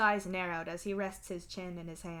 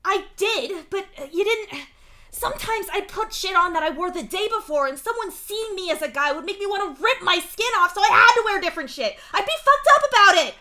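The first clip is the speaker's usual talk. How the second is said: high, loud, exasperated rant; yelling; 13 semitones higher, 15 dB louder than usual. A narrator's reading. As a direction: yell it all out, building to a screamed finish. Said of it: "he yells".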